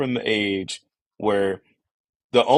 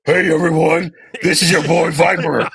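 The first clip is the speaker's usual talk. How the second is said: deep voice; 6 semitones higher, 5 dB louder than usual. Shown as a demonstration. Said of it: "you gotta go, like, super ridiculously deep".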